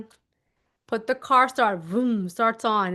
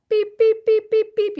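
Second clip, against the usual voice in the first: keypad beeps; 11 semitones higher, 5 dB louder than usual. Shown as a demonstration. Imitating door keypad.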